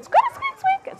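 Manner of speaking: very high squeaky chatter; tiny high mother-mouse squeaks; Mama Mouse squeaking. Acted. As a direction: give orders in mouse language. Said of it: "and she said".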